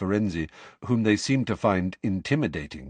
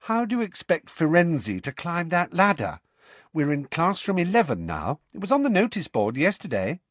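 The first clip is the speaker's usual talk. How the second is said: put-on higher, scornful girl's retort; 8 semitones higher than usual. A narrator's reading. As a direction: talk down to him over your shoulder. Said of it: "looked at him scornfully".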